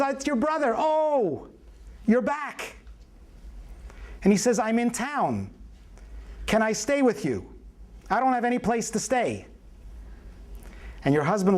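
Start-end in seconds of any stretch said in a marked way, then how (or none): 0.0-0.8 mock brother's call, pitched well up; acted brother on phone. Long-absent brother calls his sister, high-pitched announcement. 0.8-2.8 high, drawn-out acted surprise; mock surprise. Sister hears her brother is back, high mock surprise. 4.6-9.5 higher-pitched mock appeal; acted brother's request. Homeless brother asks sister for a place, high-pitched mock appeal.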